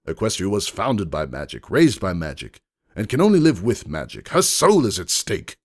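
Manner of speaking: loud, indignant declaration; shouting. A narrator's reading. Lord Hex shouting that Equestria's soul is at stake. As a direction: thunder it like a sacred creed. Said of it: "shouted Lord Hex".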